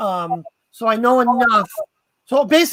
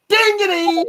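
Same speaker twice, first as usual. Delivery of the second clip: high shouted "Dignity!"; silly shout; 8 semitones higher than usual. Retold or imitated. Sneaking up on focused partner, sudden shout. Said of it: "and I'll go".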